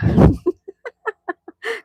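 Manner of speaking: high, loud burst of laughter. Real, not acted. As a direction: crack up loudly. Laughing at chat joke.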